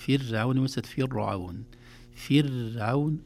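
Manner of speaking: فرعون with a thin ra, slow; light ra. Shown as a demonstration. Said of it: "الراء في فرعون مرققة". (راء مرققة في فرعون)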